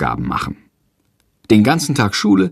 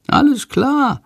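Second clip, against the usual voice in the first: high, bright creature chirp; much higher creature voice; Shandor voice; 14 semitones higher, slower than usual. A narrator's reading. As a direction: answer like a tiny eager bat. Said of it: "antwortet Shandor".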